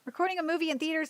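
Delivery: usual speaking voice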